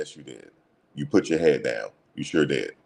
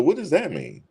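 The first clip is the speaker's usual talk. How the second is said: imitating Shedeur; 12 semitones higher than usual. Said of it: "He said".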